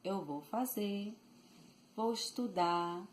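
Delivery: "fazer, estudar" ending on open vowels, no R; dropped final R. Shown as a demonstration. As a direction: leave off the R closing "fazer" and "estudar". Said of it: "it applies even more with the verbs".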